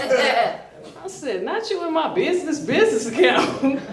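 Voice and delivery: laughing. Retold or imitated. Laughing retort at homeless man.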